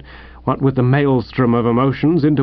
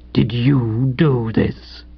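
very slow, strangled accusation; low, strangled; 3 semitones lower, much slower than usual. A narrator's reading. Host finding his soaked bed, low and strangled. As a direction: choke out each word slowly and low. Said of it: "in a low, strangled sort of voice".

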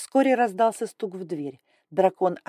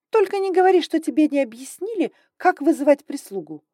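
higher, louder sharp reproach; 9 semitones higher, 7 dB louder than usual. Acted. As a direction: say it sharply, in disbelief. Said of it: "резко произнесла".

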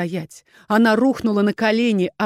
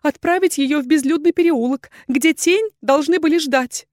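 high, anxious woman's voice; frightened confession; fearful explaining; 8 semitones higher, 4 dB louder than usual. A narrator's reading. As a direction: justify yourself in a high, pleading voice. Terrified woman explains herself to duke.

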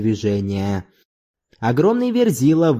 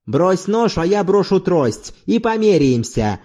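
higher-pitched angry challenge; restrained anger; 7 semitones higher than usual. A narrator's reading. Zorbas daring his rival to fight unarmed, angry. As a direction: throw down the challenge with contained anger. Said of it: "голосом, который дрожал от гнева".

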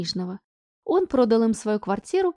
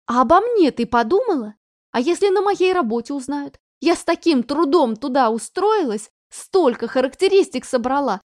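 higher, louder reproachful mother; angry mother; 4 semitones higher, 5 dB louder than usual. Acted. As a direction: pile the blame on her. Mother scolds daughter.

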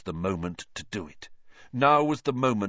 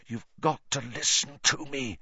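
urgent; 4 semitones higher, 3 dB quieter than usual. A narrator's reading. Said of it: "a note of urgency in his voice".

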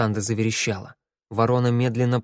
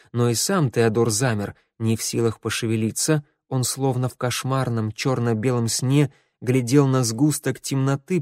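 ordinary delivery

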